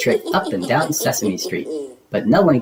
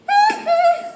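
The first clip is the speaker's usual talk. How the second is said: very high witchy cackle; high cackling laugh; witch cackle. Acted.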